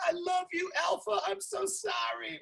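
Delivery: very high, soft squeaky dog voice; cartoonish contrite pet squeal; apologetic dog. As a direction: plead tiny and high, slowing at the end. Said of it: "she's looking at me like".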